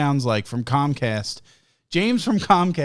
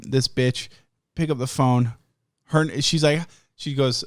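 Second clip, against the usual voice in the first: ordinary talk, nothing marked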